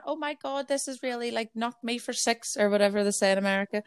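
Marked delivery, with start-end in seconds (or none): 0.0-2.5 high, softer put-on distress; pitched-up melodrama, slowing at the end; mock online shock. Host imitates everyone on Twitter, high mock shock. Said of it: "everyone on Twitter's going".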